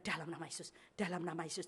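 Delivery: low, very soft praying; hushed prayer. Retold or imitated. Preacher prays before opening the oven, hushed. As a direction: pray it under the breath.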